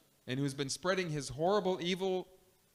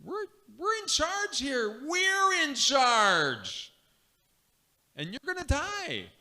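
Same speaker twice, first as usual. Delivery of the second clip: higher, slow, louder sneering taunt; mocking leaders; 11 semitones higher, 5 dB louder, slower than usual. Council mocking Jesus before his death, loud high gloating. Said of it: "And they're saying".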